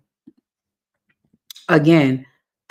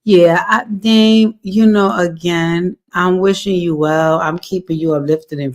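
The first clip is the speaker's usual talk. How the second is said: ordinary delivery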